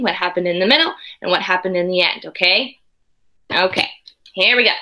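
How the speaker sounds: usual speaking voice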